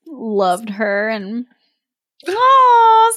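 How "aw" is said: high, loud, tender aw; an aw that melts at the sweet part; touched aw. Real, not acted.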